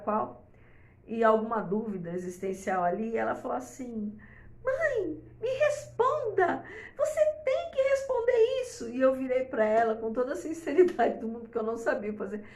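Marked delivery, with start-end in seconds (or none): 4.6-8.9 voz de filha bem mais aguda e insistente; imitando a filha. Retold or imitated. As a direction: imite a adolescente cobrando a mãe, bem aguda. Filha adolescente cobrando a mãe. (imitando a filha adolescente, voz aguda)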